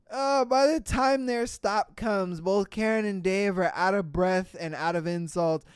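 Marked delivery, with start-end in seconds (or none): none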